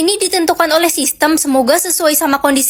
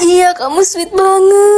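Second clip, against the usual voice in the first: teary; 3 semitones higher, slower than usual. Acted. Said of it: "Lo terharu ya?".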